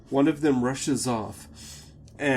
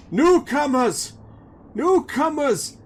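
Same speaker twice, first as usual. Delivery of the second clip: high, loud shout of alarm; yelling to the town; 9 dB louder than usual. Acted. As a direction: call out to the whole village. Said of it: "Someone yells".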